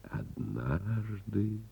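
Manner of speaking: однажды drawn out very slowly and softly; однажды, slowly savoured; slow, savored word. Acted. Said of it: "это великолепное слово".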